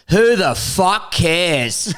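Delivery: put-on slow, drawn-out retort of an old pub regular; old barfly. Barfly heckling the visiting comedian, slow mock disdain. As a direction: play the barfly, dragging each word. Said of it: "this old barfly turns around and goes".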